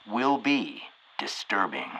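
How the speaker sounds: whispered spooky warning; ominous whisper. Acted. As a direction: drop to a dramatic whisper. Ominous warning of a disturbing sentence.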